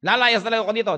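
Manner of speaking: angry threat. Retold or imitated.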